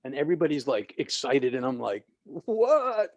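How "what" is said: high, louder, drawn-out retold reaction; stunned disbelief. New minister retelling his shock at firing threat, incredulous. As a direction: re-enact being floored, pitch leaping up. Reacting to being fired before service.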